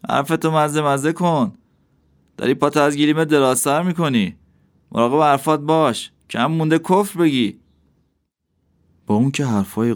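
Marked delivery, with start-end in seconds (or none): 0.1-7.6 much higher, sharp questioning judge; a proud city judge pouncing on a word; judge's voice. Acted. The judge challenging the dervish, sharply questioning.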